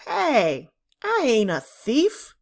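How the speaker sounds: put-on a boy jumping up to defend himself; indignant protest. A narrator's reading. Richard to Mrs Hanson, loudly protesting he isn't a thief.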